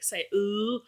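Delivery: a hint of a straining cartoon voice; lightly voiced cartoon villain; imitating Plankton. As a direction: struggle to get the word out like Plankton. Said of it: "like Plankton ... trying to say".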